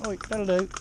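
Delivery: sharp scolding to the ponies. Shown as a demonstration. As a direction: pull them up sharply. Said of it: "see me tone of voice then?".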